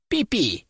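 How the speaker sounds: high rat squeak; piercing squeak. Acted. Rat king answers. (пронзительный писк)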